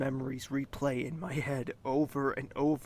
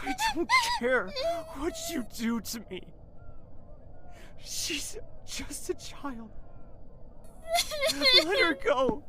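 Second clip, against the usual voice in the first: crying plea; 4 dB louder than usual. Acted. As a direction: beg through tears. Prince begs for his sister.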